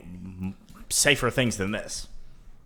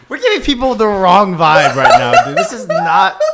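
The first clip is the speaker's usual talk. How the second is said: high, loud talk through laughter; laughing; 11 semitones higher, 11 dB louder than usual. Real, not acted. Host worries about the vibe, laughing hard. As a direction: plead while cracking up.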